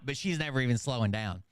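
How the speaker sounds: ordinary talk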